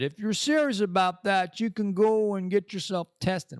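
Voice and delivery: high-pitched mock woman's voice; high, insistent quoted advice; imitating her remark.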